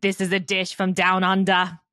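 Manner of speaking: hammed-up Australian accent, half laughing; sultry Australian accent. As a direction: play a sultry Aussie chef for laughs. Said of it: "like, Australian and sultry".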